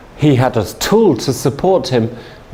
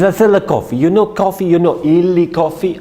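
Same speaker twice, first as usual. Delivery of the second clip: put-on light Italian accent, higher and proud; proudly name the brand, lightly accented; Italian businessman; 7 semitones higher than usual. Salesman naming his brand, proud light Italian accent. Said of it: "He says".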